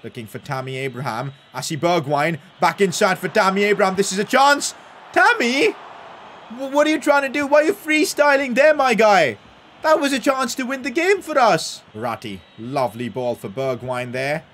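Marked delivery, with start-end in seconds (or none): 4.0-11.8 high-pitched, exasperated scolding of the player; agitated disbelief. Real, not acted. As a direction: berate your striker for the miss. Big chance wasted.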